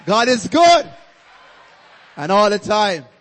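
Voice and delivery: high, very loud church call; ringing call-and-response shout. Real, not acted. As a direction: shout it out for the congregation to answer. Preacher's call to the congregation.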